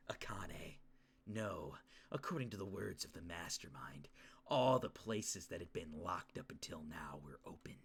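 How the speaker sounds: low, hushed brooding delivery; soft, serious inner monologue; in-character story reading. Acted. Reading Yuki reasoning about locked rooms, hushed and brooding. Reads game's dialogue in character.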